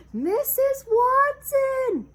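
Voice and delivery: loud, high, slow call across the house. Acted. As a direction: call her from the front door, drawn out. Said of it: "he called".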